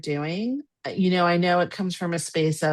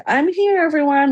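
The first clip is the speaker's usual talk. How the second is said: high, loud mock grand entrance; showy announcement; 10 semitones higher, 7 dB louder than usual. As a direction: imitate someone announcing herself to the whole room. Mom's showy entrance at funeral.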